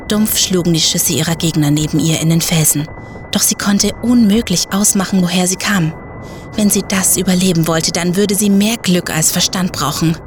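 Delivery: usual speaking voice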